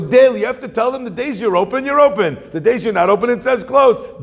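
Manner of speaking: high, loud and quick, pressing the point; excited lecturing. Acted. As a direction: hammer the logic at him. Learned man lecturing fishmonger.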